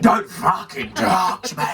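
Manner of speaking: loud, high, drawn-out yell; imitated furious shout. Living statue to man who tapped her, furious shout. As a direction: hammer out each word in a mock scream.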